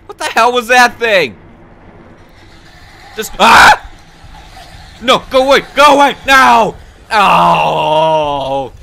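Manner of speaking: high, very loud frightened shouts; terrified screaming. Real, not acted. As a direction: shriek as it grabs you. Monster attacks again.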